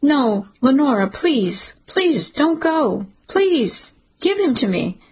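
high, desperate pleading, climbing on each please; new mother pleading with her sister to stay, desperate; begging. A narrator's reading. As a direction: beg her not to take the baby away. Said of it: "even as I begged her to stay".

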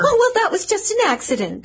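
high-pitched flustered excuse. Acted. Woman excusing spilled water, flustered. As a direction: brush off the mishap, pitch jumping up.

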